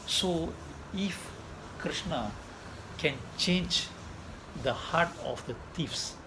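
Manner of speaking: slow, soft rhetorical questions; preaching appeal. Acted. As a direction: draw the listeners in slowly and gently.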